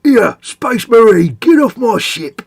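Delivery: high, slow, loud orc bellow. Acted. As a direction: roar it like an orc warboss throwing you off his ship. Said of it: "rendition of your orc voice".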